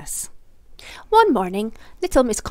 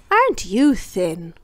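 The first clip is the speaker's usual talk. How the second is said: slow, louder, teasing compliment; mock compliment; 6 dB louder, slower than usual. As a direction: tease him with a sweet slow jab. Teasing Mr Greedy.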